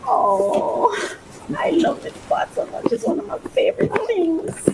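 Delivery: high, choked-up gushing; happy tears. Real, not acted. Vlogger loving her new pot so much, tearful. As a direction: gush through real tears of joy. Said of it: "I feel like I'm gonna cry".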